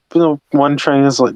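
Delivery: ordinary talk, nothing marked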